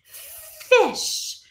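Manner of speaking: "Fish." high and drawn out, with a long f; modelling the word. Shown as a demonstration. Teacher leading the class to say "Fish." together, high and stretched. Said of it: "Say it with me".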